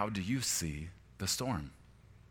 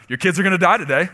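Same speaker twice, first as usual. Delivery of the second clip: a hint of a sinister lying voice, high and loud; mock enemy voice; 10 semitones higher, 12 dB louder, faster than usual. Pastor mimics the enemy's voice to his congregation, mock-sinister. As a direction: spit out the enemy's lie as a mocking taunt. Voicing enemy's lie mockingly.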